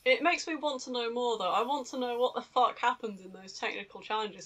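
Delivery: ordinary talk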